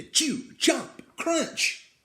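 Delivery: high and loud, bitten off; punchy sound words. A narrator's reading. Reading crunching sounds, high and loud. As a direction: bite into each sound.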